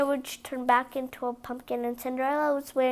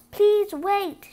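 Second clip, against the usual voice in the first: higher, louder plea to make someone stay; calling out; 8 semitones higher, 6 dB louder than usual. Acted. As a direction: Cinderella calling to the Grand Duke before he leaves. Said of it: "she called".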